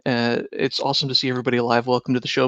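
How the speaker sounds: usual speaking voice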